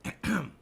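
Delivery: short mimicked throat-clear; brief demonstrative "ahem" noise; imitated throat clearing. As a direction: clear the throat as she did. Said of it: "she's going".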